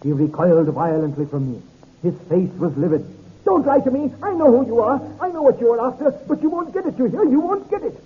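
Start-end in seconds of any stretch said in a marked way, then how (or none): 3.4-8.0 high, frantic, fearful outburst; hysterical accusation. Acted. Hysterical man accuses narrator.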